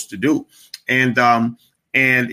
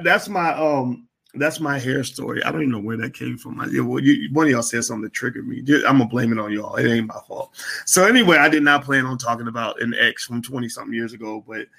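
usual speaking voice

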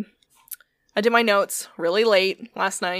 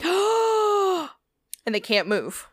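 slow, high, spooky telling; slow dramatic reveal; 10 semitones higher, much slower than usual. Real, not acted. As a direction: drag out the scary line slowly. Scary climax of paralysis story.

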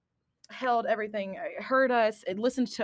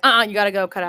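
dismissive; 8 dB louder, faster than usual. Retold or imitated. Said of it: "Versus, like".

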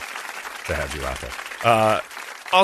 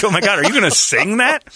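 excited; 10 semitones higher, 6 dB louder than usual. Retold or imitated. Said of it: "I'm like".

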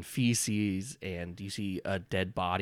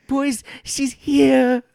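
much higher, louder, slow fawning goblin shriek; Sneep Snap; 13 dB louder, much slower than usual. Acted. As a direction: squeal adoringly, stretch the last words. Goblin leader calls his boys.

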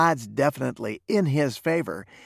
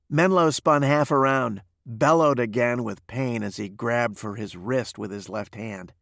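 usual speaking voice

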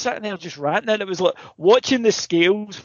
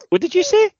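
much higher and louder, sharp challenge; incredulous cop; 5 dB louder than usual. Retold or imitated. Policeman turning on the ranting friend, indignant challenge. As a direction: bark it like an affronted policeman.

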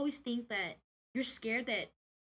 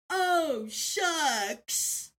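mock aw-shucks boss; 4 semitones higher, 3 dB louder than usual. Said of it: "He said".

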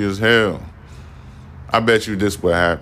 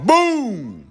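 high shouted boom; mock gunshot. Host acting out the shotgun firing, high shouted boom. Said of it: "and let it off".

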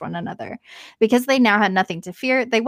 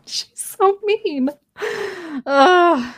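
indignant; 9 semitones higher, 3 dB louder, much slower than usual. Real, not acted. Reacting to cruel witch.

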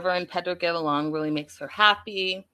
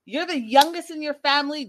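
normal talking voice

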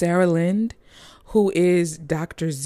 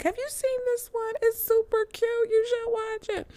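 much higher, gushing fan voice; mimicking a fan; 3 dB quieter than usual. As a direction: imitate an eager follower recommending a film. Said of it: "and was like".

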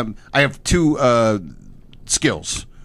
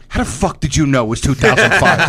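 louder, amazed shout; mock astonished friend; 4 semitones higher, 5 dB louder than usual. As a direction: friend shocked at being proven wrong. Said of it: "and he goes".

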